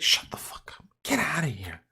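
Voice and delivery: a near-silent, breathy brush-off; whispered insult. Acted. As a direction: mutter it under your breath. Mock insults trailing into aside.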